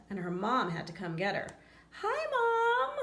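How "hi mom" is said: much higher, louder chirpy call; high squeaky greeting. Acted. Fly stuck in web greeting her mom, high squeaky call. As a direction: sing out Mom's greeting in a tiny high voice.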